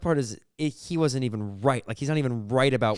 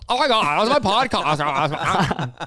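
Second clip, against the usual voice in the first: put-on laughing Valley Girl voice, higher; giggly Valley Girl gush; 4 semitones higher, 4 dB louder than usual. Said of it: "It sounds like a Valley Girl".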